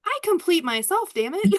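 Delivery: high, loud triumphant declaration; defiant exclamation. Retold or imitated. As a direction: proclaim it with playful defiance. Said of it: "I was like".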